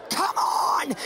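rough, strained urging; hammed-up growled rallying cry; urgent growl. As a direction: push it out with effort. Urging friend across airport.

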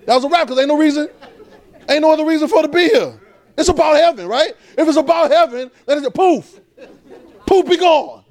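rising sermon fervor; preaching. Acted. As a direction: preach it out with full force. Preaching at full force.

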